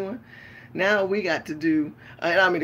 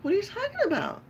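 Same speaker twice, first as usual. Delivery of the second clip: much higher, fast, innocent; Becky again; faster than usual. Pretend. Woman in Trump hat answers stares, high innocent voice. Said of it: "I act like Becky again".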